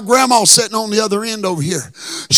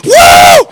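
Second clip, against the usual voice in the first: high, loud whooping cry; war whoop; 10 dB louder than usual. Retold or imitated. Imitating dad's war whoop around the church building. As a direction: let out a wild whoop. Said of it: "doing this Comanche war whoop".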